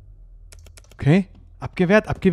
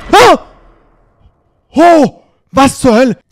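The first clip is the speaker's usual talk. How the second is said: scared horror game streamer; 10 semitones higher, 13 dB louder, slower than usual. Real, not acted.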